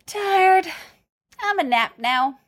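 put-on high little-child voice; small child Grogu. A narrator's reading. Grogu announcing his nap, high and childlike. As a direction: pipe up high like a sleepy toddler.

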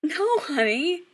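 high, drawn-out, amused correction. A narrator's reading. Mother correcting her mistaken son, amused. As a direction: let it out slowly with a hint of amusement. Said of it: "Kim says with a laugh".

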